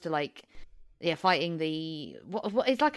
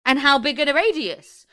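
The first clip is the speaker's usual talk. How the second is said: louder, higher, slow disbelieving laugh; louder mock outrage at the rain logic; incredulous outburst; 7 semitones higher, 10 dB louder, slower than usual. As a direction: demand it like it makes no sense, laughing. Mocking the film's logic incredulously.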